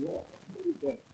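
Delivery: breathless hushed alarm, pitched high; startled whisper. Retold or imitated. Husband hears the spirit chanting and whispers in alarm. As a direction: whisper it, spooked by a sound in the dark.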